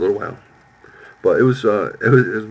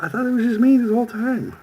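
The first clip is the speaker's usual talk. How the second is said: mock whiny high voice. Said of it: "I said".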